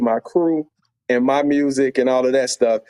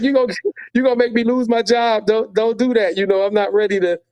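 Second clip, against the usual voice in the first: higher, urgent plea, retold; anxious pleading; 8 semitones higher than usual. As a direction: plead with her not to get him fired. Pleading, fearing for his job.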